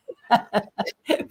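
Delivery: a short high embarrassed laugh, soft. Real, not acted. Laughing off the praise from the interviewer, a short shy laugh.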